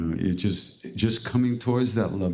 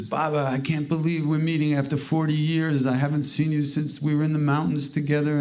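put-on lifted, joyful old friend voice; excited reunion; 7 semitones higher than usual. Sadhu overjoyed to meet Maharaj again, excited. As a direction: greet him like a long-lost brother.

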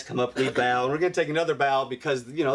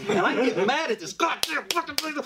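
ordinary talk, nothing marked

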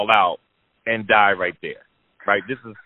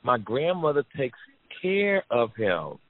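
higher, slow, innocent explaining; higher girl's voice, slowing at the end; daughter's voice; 5 semitones higher than usual. Retold or imitated. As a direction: tell it gently like a kid. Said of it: "She said".

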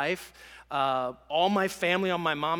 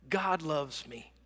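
normal talking voice

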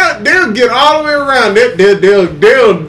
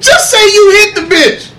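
excited repeat; 11 semitones higher, 4 dB louder than usual. Real, not acted. Repeats line, laughing.

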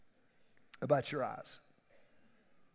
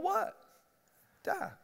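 DIE jumps very high and sharp; emphatic die; 13 semitones higher than usual. Acted. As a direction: land "Die." hard and high as a final warning. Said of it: "She's pretty emphatic".

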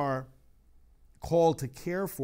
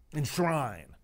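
scornful echo; 3 dB louder than usual. Acted. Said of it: "They use the word enshrine".

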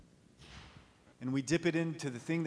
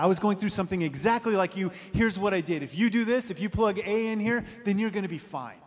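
higher, louder, fast pushy reassurance; mock know-it-all advice; 4 semitones higher, 5 dB louder than usual. Preacher mocks people handing out quick fixes, loud and fast. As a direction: rattle off easy answers like an expert. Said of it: "We still say that".

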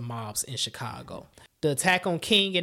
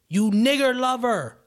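angry scream; 8 semitones higher, 7 dB louder, slower than usual. Retold or imitated. Heckler screaming at Mondale in rage. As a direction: spit the slur out with full hatred. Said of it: "screamed at Mondale".

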